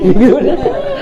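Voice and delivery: a burst of high laughter. Real, not acted. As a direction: laughs at his own fake bravado, laughing high. Laughs at own bluff.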